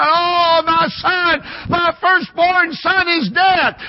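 high, slow grieving shout; great cry. Acted. Another parent mourning the firstborn, grieving cry. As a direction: cry it out over the lost son. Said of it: "comes out with a great cry".

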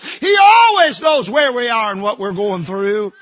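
rising pulpit fervour, stressing NEVER; full-force preaching. Acted. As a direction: preach it out to the whole church.

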